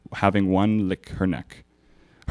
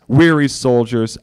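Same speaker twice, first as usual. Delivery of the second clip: ordinary delivery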